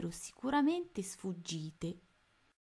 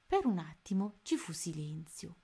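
plain reading voice

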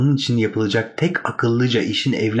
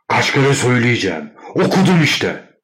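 exasperated yell, rising higher; angry shout; 3 semitones higher, 4 dB louder than usual. A narrator's reading. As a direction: shout down your nagging sister. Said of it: "diye bağırıyordu". (bıkkın, öfkeli bağırış)

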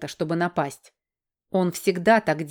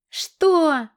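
high, louder squeal of alarm; startled squeak; 9 semitones higher, 5 dB louder than usual. A narrator's reading. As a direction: squeak it out, much higher and louder. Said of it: "пискнул Аргаран". (испуганный писк, высоко и громко)

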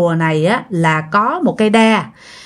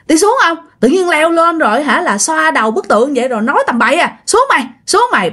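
high-pitched, urgent telling-off; retold scolding; 7 semitones higher, faster than usual. Friends order girl off the statue, scolding. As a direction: imitate friends ordering her down crossly. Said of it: "mấy người mới chửi dì Tâm quá trời".

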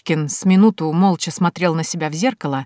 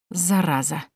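ordinary delivery, nothing marked

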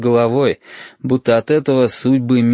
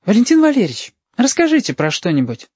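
put-on high child's voice asking for a story; eager, high little-girl request; pleading child; 11 semitones higher than usual. A narrator's reading. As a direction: pitch it up like a girl coaxing a grown-up. Child asks adult for a story.

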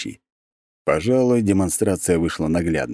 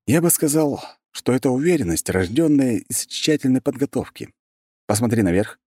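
put-on raised, assured young man voice; calmly certain, a touch above him; confident young hero; 7 semitones higher than usual. A narrator's reading. Narrator voicing hero lines.

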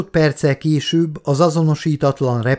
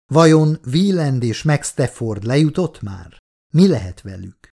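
ordinary delivery

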